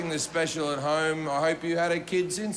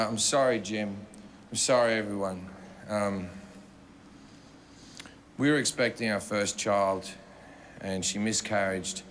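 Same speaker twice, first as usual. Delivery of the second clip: much lower and subdued, a quiet apology; a broken husband apologising to the room; grieving husband; 9 semitones lower, 3 dB quieter than usual. Retold or imitated. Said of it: "and he goes".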